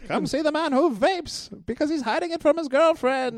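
high barker call; carnival barker. Acted. Barker announcing the secret vaper. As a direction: hawk it like a sideshow act.

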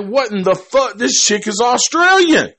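high, loud what-the-fuck reaction; mock disbelief. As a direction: replay your own shock at the news. Retold own reaction, shocked.

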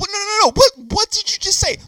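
high, loud disbelieving shout; what did you just say, yelled; shocked outburst. Real, not acted. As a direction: cut him off in shock, shouting.

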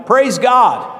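high, loud shout of praise. Acted. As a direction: shout it out jubilantly.

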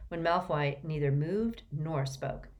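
ordinary delivery, nothing marked

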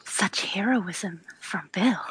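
slow, hushed aside; soft, slow whisper; in-character whisper. Acted. Player speaks in character.

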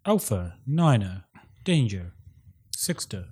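slow flat recited code words; numbers station monotone. Retold or imitated. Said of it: "phonetic alphabet being read out".

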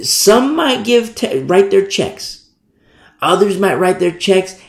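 drawn out high voice, slowed right down. Real, not acted. Preacher to congregation, high and slow.

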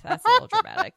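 high, dry mock scolding; high sarcastic aside. Real, not acted. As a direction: say it high and dryly.

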